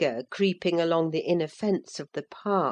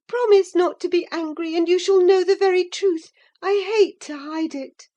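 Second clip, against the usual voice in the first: put-on much higher young girl, earnest; pleading girl; 13 semitones higher, 6 dB louder, faster than usual. A narrator's reading. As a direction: coax her to promise. Catherine pleading with Ellen.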